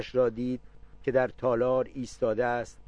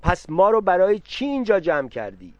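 loud and much higher, flaring up; shouting; 7 semitones higher, 6 dB louder than usual. A narrator's reading. Aunt Khanomu shouting at the father in a rage. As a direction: burst out in a wailing shout, dragging out the lament. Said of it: "از جا در رفت و فریاد زد".

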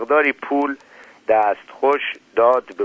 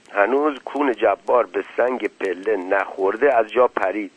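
usual speaking voice

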